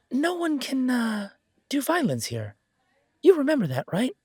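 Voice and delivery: nervous barkeep pleading for peace; anxious barkeep. Acted. [name] warns about the wards.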